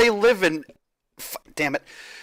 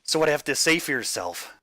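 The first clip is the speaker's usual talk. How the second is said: menacing yandere; 5 semitones lower, 7 dB quieter than usual. Acted. Yandere interrogating over the phone.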